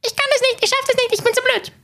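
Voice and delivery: very high-pitched squeaky cartoon voice; Mickey Maus. Shown as a demonstration. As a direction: say it in a tiny, sky-high squeak. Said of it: "diese Stimme nennt sich die Mickey Maus Stimme". (extrem hohe Piepsstimme)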